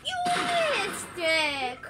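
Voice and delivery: loud slow drawn-out jeer; loud taunt. Real, not acted. Repeats taunt louder.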